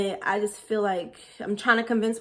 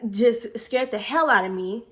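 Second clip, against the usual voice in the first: ordinary delivery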